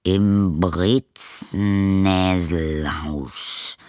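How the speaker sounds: very slow, drawn-out reading of Breznäselhaus; slow puzzled reading. Acted. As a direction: spell out the strange word slowly. Postman reads odd address.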